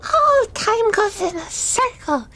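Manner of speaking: high, slow dawning wonder; sudden realisation. Acted. Player as Hazel working out time magic, high dawning realisation. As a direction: draw it out high as it clicks.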